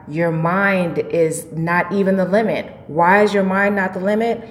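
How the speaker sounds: usual speaking voice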